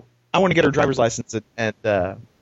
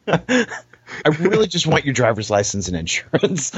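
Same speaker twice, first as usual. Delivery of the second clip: exasperated retort; 5 semitones higher than usual. Retold or imitated. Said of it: "look at him like".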